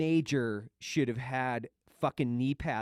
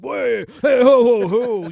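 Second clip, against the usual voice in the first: much higher and louder, blustering; hammed-up authoritative shout; mock commanding bark; 12 semitones higher, 16 dB louder, slower than usual. Shown as a demonstration. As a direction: halt everyone like a puffed-up officer. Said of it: "if I get really authoritative".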